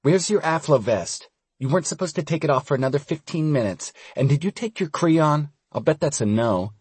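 put-on high-pitched, brisk girl's nagging; bossy girl scolding. A narrator's reading. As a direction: scold like a girl keeping tabs on treatment. Stella scolding in higher voice.

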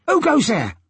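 high-pitched sailor calling out; sailor's challenge. A narrator's reading. Sailor challenges someone at the rail, high call. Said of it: "a sailor's voice behind Asta".

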